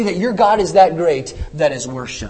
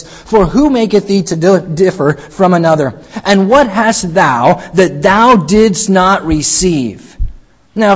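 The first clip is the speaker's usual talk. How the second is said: louder, slow King James reading from the pulpit; preached scripture reading; 5 dB louder, slower than usual. Acted. Pastor reading First Corinthians aloud to the church, louder preaching voice. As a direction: proclaim the verse to the congregation.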